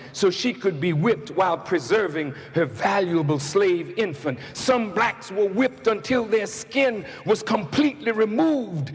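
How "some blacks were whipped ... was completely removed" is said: fiery preaching. Acted. Preacher to congregation about slavery, full-force shouting preach.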